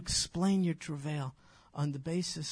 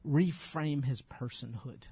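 ordinary delivery

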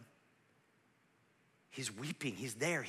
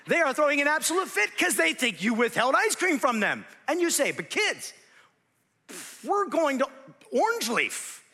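high, loud, indignant preacher; full-force preaching; 12 semitones higher, 10 dB louder than usual. Real, not acted. Preacher telling of kids' tantrum, full-force delivery. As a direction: preach it at a near shout.

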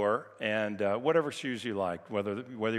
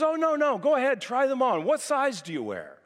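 eager stranger; 12 semitones higher, 4 dB louder than usual. Retold or imitated. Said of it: "So he says".

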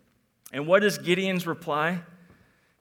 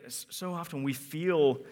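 ordinary talk, nothing marked

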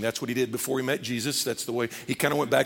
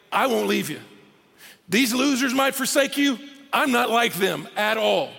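higher-pitched cocky boast; flexing bravado; 6 semitones higher than usual. Acted. Peter telling Jesus he won't leave like those losers, cocky. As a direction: swear loyalty with a swagger. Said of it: "Peter's the one that sorta flexes".

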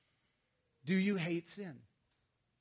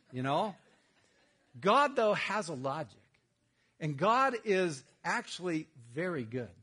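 ordinary talk

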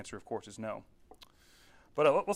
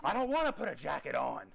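imitating his toddler; 3 semitones higher than usual. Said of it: "That's my daughter".

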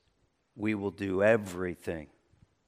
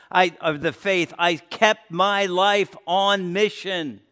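higher, louder preaching build to the climax; full-force preaching; 7 semitones higher, 6 dB louder than usual. Acted. Pastor rallies the church about its mission, full preaching voice. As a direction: lift the congregation, preach it out.

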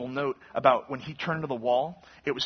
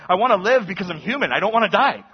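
louder, higher desperate plea; pleading cry; 5 semitones higher, 10 dB louder than usual. Retold or imitated. Hezekiah begging God to let him live, loud plea.

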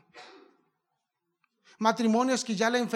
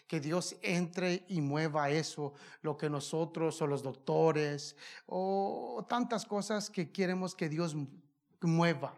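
slow, hushed plea for God to move; soft prayerful plea; 4 semitones lower, 6 dB quieter than usual. Acted. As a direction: pray it softly, pleading slowly. Intimate prayerful preaching. (súplica lenta y suave)

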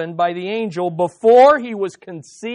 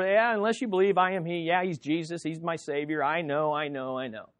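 quick, dismissive mumble fading softer and lower; slipping over it; 3 semitones lower, 6 dB quieter than usual. Acted. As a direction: rattle it off as if already known, trailing away. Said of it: "we just slip over".

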